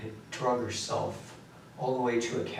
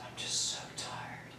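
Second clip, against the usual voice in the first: voiceless, much softer whisper; tired whisper; 9 dB quieter than usual. Retold or imitated. Telling his brother he can't get up, exhausted whisper. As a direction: breathe it out, spent.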